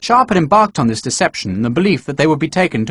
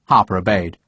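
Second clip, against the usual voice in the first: usual speaking voice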